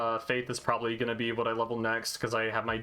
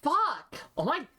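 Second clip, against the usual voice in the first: put-on valley girl; 12 semitones higher, slower than usual. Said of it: "you kinda went Valley Girl there".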